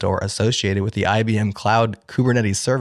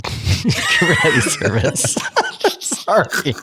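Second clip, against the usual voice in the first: reading through laughter; laughing; 14 semitones higher, much slower than usual. Real, not acted. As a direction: fight the giggles while reading. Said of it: "I couldn't even keep a straight face".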